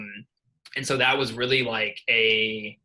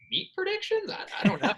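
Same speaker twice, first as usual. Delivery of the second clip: squeaky, much higher confused voice; baffled high voice; faster than usual. Retold or imitated. Telling Will he has no idea, in a squeaky baffled voice. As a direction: pitch way up in mock bewilderment.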